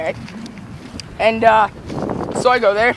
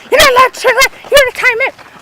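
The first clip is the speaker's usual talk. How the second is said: put-on very high little-boy voice; taunting kid; 13 semitones higher, 3 dB louder than usual. Cousin polices the timeout, high taunting kid voice. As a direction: squeak it like a bossy kid.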